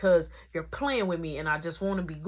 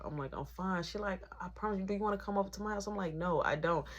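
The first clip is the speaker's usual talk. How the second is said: hushed; 3 semitones lower, 7 dB quieter than usual. Retold or imitated. Hushed break-room talk with coworker.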